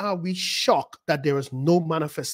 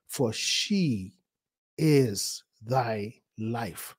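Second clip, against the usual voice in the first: low, drawn-out scripture, softer; very slow, deliberate quote; 7 semitones lower, 5 dB quieter, much slower than usual. Real, not acted. Preacher quoting instruction as life, slow and deliberate. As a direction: spell it out word by word, weightily. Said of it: "why are you saying it like that".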